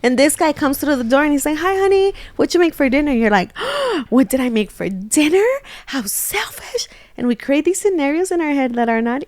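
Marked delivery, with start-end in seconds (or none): 1.6-3.1 high, chirpy homecoming greeting; sweetly oblivious husband asking, pitched up; cheery husband. Acted. Acts husband cheerfully arriving home. 3.6-4.0 sharp, high intake of breath; mock offended gasp. Wife acts shocked at husband's question. 4.1-6.9 mock outrage. Acted wife outraged by dinner question.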